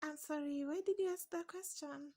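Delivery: normal talking voice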